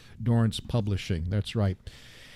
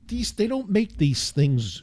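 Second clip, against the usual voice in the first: normal talking voice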